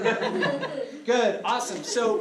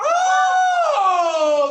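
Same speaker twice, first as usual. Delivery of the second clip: high, loud mock-offended oh; offended shriek; 11 dB louder than usual. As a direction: yelp in mock outrage. Offended outburst in scene.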